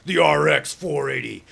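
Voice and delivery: slow, loud announcer boom; monster truck voice. Shown as a demonstration. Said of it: "give it, like, a monster truck voice for it".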